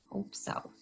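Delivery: breathy whispered aside. Real, not acted. Reader whispering her hope to the kids. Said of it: "whispered aside".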